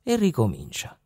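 plain reading voice

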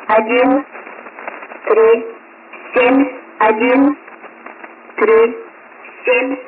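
usual speaking voice

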